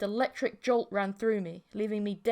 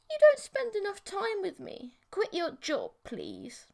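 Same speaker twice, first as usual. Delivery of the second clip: whiny pleading; 10 semitones higher than usual. Acted. Monster girlfriend pleading, high.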